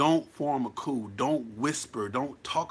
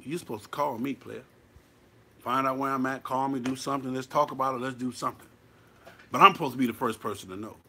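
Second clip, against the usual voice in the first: ordinary delivery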